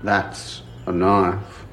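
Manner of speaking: low, slow Aussie "That's a knife"; Dundee knife line. Pretend. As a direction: drop low and drawl out the famous line. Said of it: "Miles wears a Crocodile Dundee hat".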